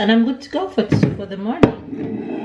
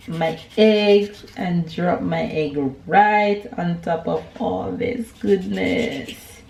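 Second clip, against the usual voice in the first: normal talking voice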